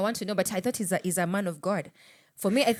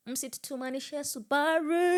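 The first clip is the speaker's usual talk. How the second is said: slow, higher drawn-out last word; mock catchphrase; 7 semitones higher, slower than usual. Host mocking the political class, teasing drawl. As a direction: stretch it out like a teasing slogan.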